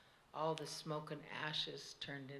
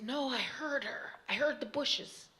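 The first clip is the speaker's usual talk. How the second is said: higher, louder insisting; insistent child; 8 semitones higher, 5 dB louder than usual. A narrator's reading. Girl insisting to the other kids, higher insistent voice. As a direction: press the point, she's sure.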